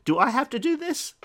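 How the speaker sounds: high, loud exasperated plea; exasperated whine. Acted. Jesus voicing his weary exasperation, high and loud. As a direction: groan it out like a weary teacher.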